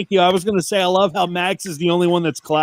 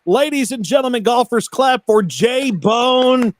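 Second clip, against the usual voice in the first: announcer call; 6 semitones higher than usual. Acted. Announcing guest to audience.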